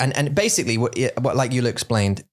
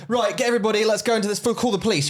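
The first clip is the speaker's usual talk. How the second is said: mock take-charge; 10 semitones higher, faster than usual. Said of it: "you definitely wouldn't go".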